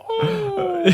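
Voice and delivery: high, long creaking "oh"; Andy. Retold or imitated. Hearing a friend's voice in the door's creak, high mocking noise. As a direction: creak it out high like a door hinge. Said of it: "just hearing his voice going".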